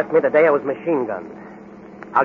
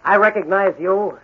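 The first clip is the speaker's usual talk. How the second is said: higher, louder taunting challenge; defiant shout; 8 semitones higher, 4 dB louder than usual. Acted. Hero daring the gangsters to fire, defiant shout. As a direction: dare them to shoot.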